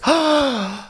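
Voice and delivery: mock gasp. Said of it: "and Renee goes".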